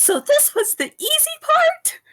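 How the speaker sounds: very high, drawn-out incredulous cry; in-character shout. Acted. Yorkia shouts on seeing the others, high mock shout. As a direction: shout it high and slow, rising at the end. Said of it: "she, like, shouts".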